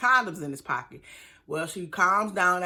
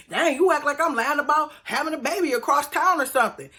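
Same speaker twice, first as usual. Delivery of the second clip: smart-mouthed husband; 8 semitones higher, 4 dB louder than usual. Retold or imitated. Husband getting smart back.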